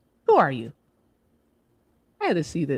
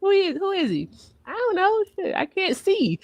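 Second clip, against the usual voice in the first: high, squeaky retold panic; high-pitched mock bewilderment; 13 semitones higher than usual. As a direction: pitch way up like a confused onlooker. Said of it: "I was like".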